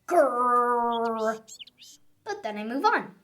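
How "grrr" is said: drawn-out frustrated growl; little frustrated grrr. Shown as a demonstration. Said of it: "Like this".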